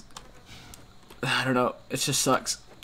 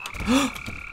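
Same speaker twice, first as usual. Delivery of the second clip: sharp gasp; 8 semitones higher, 7 dB quieter than usual. Real, not acted. Sudden fright.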